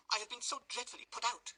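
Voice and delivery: high, fluttering lady's voice; distressed matron complaining; fussy matron voice. Acted.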